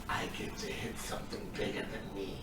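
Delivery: soft, low, voiceless kobold aside; hushed kobold whisper; kobold's secret glee. Acted. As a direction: whisper it as a small creature's private thought. Game master voices the gleeful kobold's thought.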